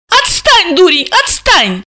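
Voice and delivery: high-pitched protesting yell; resisting cry. A narrator's reading. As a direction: cry out to shake him off. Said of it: "артачилась и кричала".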